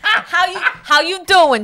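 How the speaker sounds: mock New York pickup line; New York accent. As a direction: drawl it like a Jersey charmer. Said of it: "He's from New York".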